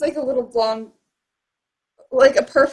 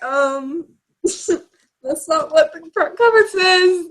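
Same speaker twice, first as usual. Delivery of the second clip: mock incredulous; 5 semitones higher, slower than usual. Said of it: "And I'm like".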